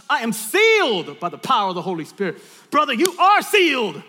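loud, preacherly declaration; rousing proclamation. Shown as a demonstration. Preacher shows partners what to proclaim, loud rousing delivery. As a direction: build to an exultant shout. Models congregation's proclamation, building up.

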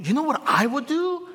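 mock eager pastor voice. Shown as a demonstration. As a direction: lift it into a playful voice.

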